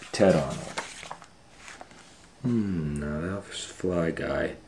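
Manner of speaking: plain reading voice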